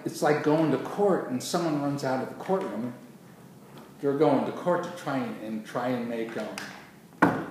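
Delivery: ordinary delivery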